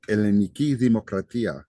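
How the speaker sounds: "Elleniki Demokratia" in fluent Greek, "dhee-mo-kra-TEE-a"; Greek pronunciation. Shown as a demonstration. Teacher showing off Greek to class, Greek pronunciation. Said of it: "what it is in Greek".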